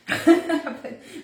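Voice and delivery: short, loud, high burst of laughter; amused laugh. Real, not acted. Amused at own accent.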